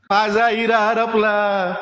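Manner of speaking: high, drawn-out lament; wailing cry. A narrator's reading. Dead man's mother beating her chest by the river, wailing. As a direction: cry out slowly like a mother in mourning. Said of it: "ऊर बडवत ओरडली".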